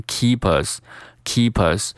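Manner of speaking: "keep us" run together as one word, kee-pus; linked keep us. Shown as a demonstration. Teacher demonstrating linking to learners, "keep us" said as kee-pus. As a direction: glide the p of "keep" straight into "us". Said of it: "需要进行连读".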